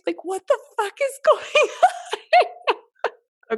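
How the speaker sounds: slow, high, laughing disbelief. Real, not acted. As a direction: let the laugh take over the question. Overwhelmed by surprise flowers.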